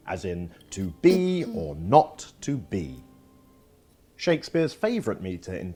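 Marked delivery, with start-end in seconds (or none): 0.7-3.0 "to BE or NOT to BE", slow, strong second beats; iambic stress. Shown as a demonstration. Teacher demonstrating iambs, "to BE or NOT to BE" with weak-strong beats. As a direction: stretch and lean on BE, NOT, BE in "to be or not to be". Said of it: "first syllable is unstressed and the second is stressed".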